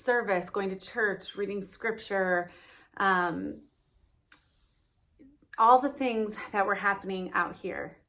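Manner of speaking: usual speaking voice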